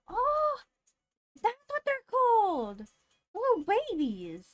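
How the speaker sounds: high, loud excited squeal; delighted gushing. Real, not acted. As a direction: gush over tiny puppies, pitch soaring then sliding down. Gushing over dog breed pictures.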